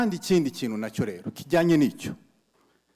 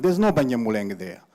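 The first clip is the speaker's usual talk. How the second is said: normal talking voice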